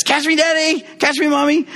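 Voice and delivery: put-on squealing high child voice; a tiny kid begging to be caught; excited child. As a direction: call out like an eager little kid. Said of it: "the little one says".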